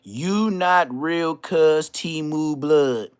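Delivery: slow mocking quote. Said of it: "This brother said".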